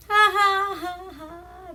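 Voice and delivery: high, light laughing ha ha; mock the shared chuckle, high and light; sweet little laugh. Aunt and doctor share a joke, high light laugh. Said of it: "They have a good, sweet little laugh".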